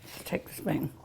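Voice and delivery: sneaky whisper. Real, not acted. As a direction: whisper it conspiratorially. Whispered aside.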